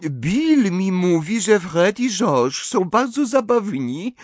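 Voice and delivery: put-on high, slow, smiling young woman; cheerful Fleur. A narrator's reading. Fleur praises the twins to the table, cheerfully. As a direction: sing it out sweetly and breezily. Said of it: "uśmiechając się pogodnie".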